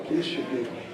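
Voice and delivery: soft, low plea for forgiveness; subdued confession, voice dropped; penitent begging. Acted. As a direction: beg quietly, full of remorse. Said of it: "he begged".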